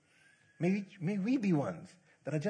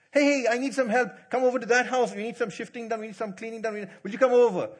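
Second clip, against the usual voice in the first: high, loud, acted-out plea; calling for help; 8 semitones higher, 9 dB louder, faster than usual. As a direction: call a friend over urgently, pitched up. Acting out someone calling for help.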